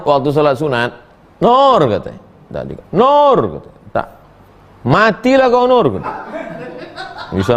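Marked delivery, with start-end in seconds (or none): 1.4-1.8 raised, insistent call of the name; a mother calling her child from afar. Retold or imitated. Mother calls daughter again, louder call. 2.9-3.4 raised call of the name, insistent; calling a child who won't answer. Retold or imitated. Mother keeps calling daughter, raised call. 4.8-5.9 high, exasperated mother's outburst; a mother losing patience, half joking; mock curse. Mother scolds daughter who won't answer, exasperated.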